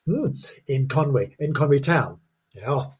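usual speaking voice

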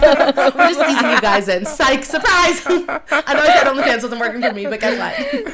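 loud and high, talking through laughter; laughing. Real, not acted. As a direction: laugh all through the teasing announcement. Joking about OnlyFans, laughing.

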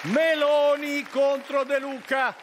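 high, slow, stretched-out announcing; big drawn-out stage introduction; show announcer. Acted. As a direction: call out the credits over music. Announces band and next segment.

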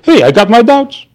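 high-pitched mimicry of a doubter; lightly mocking, high-voiced protest; mock self-justifying. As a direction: pipe up as if doubts were your right. Mimicking someone casually claiming doubts.

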